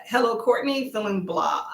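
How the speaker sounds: ordinary delivery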